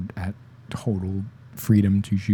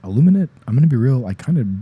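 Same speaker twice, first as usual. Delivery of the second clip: normal talking voice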